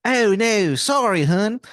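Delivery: very high-pitched, hammed-up Maryland accent; Wizard of Baltimore, awful Maryland accent. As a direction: squeal it in the worst Maryland accent. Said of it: "he has the worst Maryland accent".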